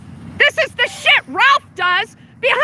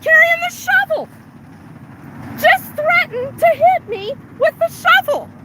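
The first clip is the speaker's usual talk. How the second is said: heated accusation; 6 semitones higher than usual. Real, not acted. Reporting threat to passerby, heated.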